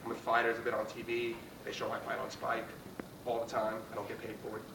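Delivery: usual speaking voice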